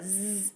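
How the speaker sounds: "Z" as a sustained voiced "zzz"; buzzed Z sound. Shown as a demonstration. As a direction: hold the buzz of "Z" without the letter name. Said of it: "a Z sound".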